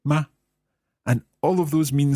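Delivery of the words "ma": "Ma" echoed short and light; a quick, light "Ma"; neutral tone. Shown as a demonstration. Learner copying the teacher, "Ma" short and light. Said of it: "very short and light".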